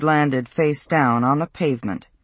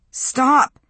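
high, urgent cry to halt a fight; 10 semitones higher, 4 dB louder than usual. A narrator's reading. Josie watches Matt attack Peter, urgent cry. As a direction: cry out to break it up. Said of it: "she cried".

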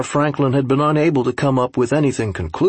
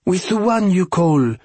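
higher-pitched, incredulous aide voice; French aide, challenging; 6 semitones higher than usual. A narrator's reading. Aide asking Franklin how he managed before, challenging. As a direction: press the question as an accented interpreter.

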